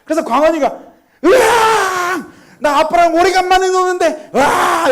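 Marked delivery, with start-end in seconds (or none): 1.2-4.9 high, loud, drawn-out mock tantrum; child's angry outburst. Older son whose game with dad was ruined, imitated angry yell. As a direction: wail in protest like a furious kid. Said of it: "화를 내기 시작하면서".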